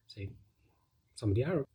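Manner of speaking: ordinary talk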